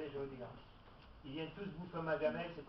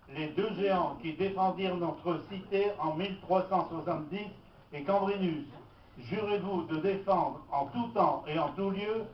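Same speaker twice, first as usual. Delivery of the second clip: formule d'intronisation solennelle et appuyée; déclamer le serment, lentement et fort; 10 dB louder than usual. Acted.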